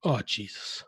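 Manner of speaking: low, soft exclamation of dismay; quietly appalled onlooker; muttered dismay. Acted. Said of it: "you can hear her go".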